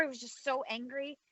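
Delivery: ordinary delivery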